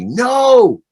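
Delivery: sharp loud no, high; retold mother's sharp yell; mom's yell. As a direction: bark it like a mother stopping a child. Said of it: "and yelled at me".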